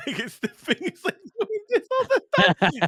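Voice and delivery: can barely get it out for laughing; helpless giggling description. Real, not acted. Laughing while describing shaky platform.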